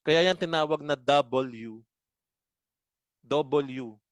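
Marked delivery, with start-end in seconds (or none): 3.3-4.0 "W" spoken in parts as double-U. Shown as a demonstration. Teacher explains the letter's name, "W" said as double-U. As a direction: say "W" clearly as double plus U. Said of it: "yung bigkas nun is U".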